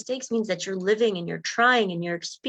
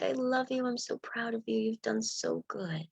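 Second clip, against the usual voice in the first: tender self-praise; 5 semitones higher, 6 dB quieter than usual. Acted. Loving words to oneself.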